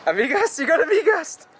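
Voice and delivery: high surprised questions. Real, not acted. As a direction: sound amazed at the word. Surprised at the word.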